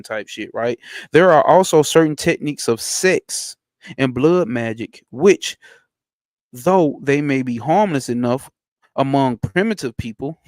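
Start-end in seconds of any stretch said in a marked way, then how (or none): 1.1-10.4 loud, emphatic book reading, raised and ringing; reading aloud. Acted. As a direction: declaim the passage like a sermon. Reads occult book aloud.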